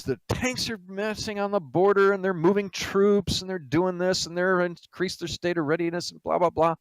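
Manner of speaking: high-pitched frantic report; apoplectic. Acted. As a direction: blurt the alarm out in a high, agitated voice.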